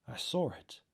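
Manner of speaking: ordinary delivery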